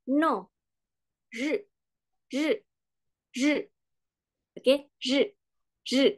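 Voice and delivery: "zhi" short and falling like "no"; fourth tone. Shown as a demonstration. Teacher drills the word for day, "zhi" falling short. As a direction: drop sharply on each "zhi". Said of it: "Kemudian nada keempat".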